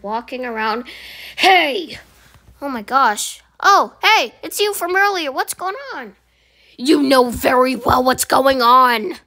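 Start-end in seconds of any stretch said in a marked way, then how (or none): 1.4-6.2 higher, chirpy employee voice; a cheerful worker caught off guard; nervous employee. Acted. Employee greets returning customer. 6.8-9.1 slow, louder accusation; cold anger. Acted. Angry customer confronts employee.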